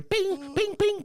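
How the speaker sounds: high-pitched "ping" sounds; imitated pinging. Gamer showing his pings on the map, high imitated blips. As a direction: mimic a game alert in a high voice.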